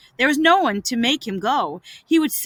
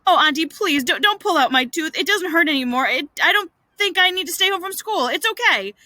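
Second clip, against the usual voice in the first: higher, hurried pleading; begged; 6 semitones higher, faster than usual. Acted. Boy begging his aunt to spare his tooth, pleading. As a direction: plead your way out of it. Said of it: "Tom begged".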